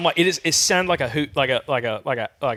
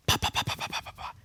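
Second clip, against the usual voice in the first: voiceless, rapid pops fading softer; imitated wing beats; 9 dB quieter than usual. Shown as a demonstration. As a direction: pop out wingbeats, trailing away quietly. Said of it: "take that hat off and go".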